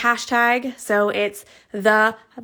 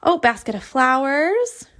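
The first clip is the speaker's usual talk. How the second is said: ordinary talk, nothing marked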